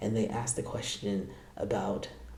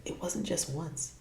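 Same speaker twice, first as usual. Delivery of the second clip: sly whisper; 5 semitones higher, 5 dB quieter than usual. Retold or imitated. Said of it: "She's like".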